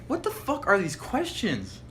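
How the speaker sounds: very high, incredulous retold protest; exasperated disbelief. Guy replays his bafflement at the Disney question, exasperated.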